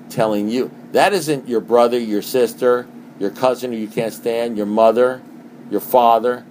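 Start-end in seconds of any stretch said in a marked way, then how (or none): none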